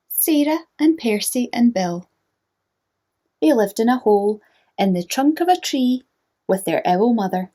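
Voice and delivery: bright, lively storybook read-aloud; reading to children. A narrator's reading. Teacher reading a picture book to young listeners, animated. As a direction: read it like bedtime story time for little ones.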